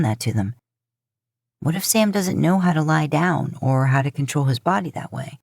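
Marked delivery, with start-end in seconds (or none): none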